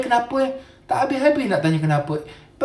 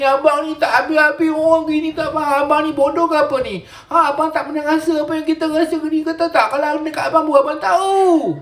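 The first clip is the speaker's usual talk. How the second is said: mock tearful wailing, much higher pitch; rengekan menangis; 7 semitones higher than usual. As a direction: whine through fake sobs at a husband. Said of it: "Jangan nangis-nangis". (merengek tinggi pura-pura menangis)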